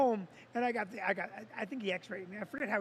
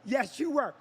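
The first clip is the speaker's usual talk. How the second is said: ordinary talk, nothing marked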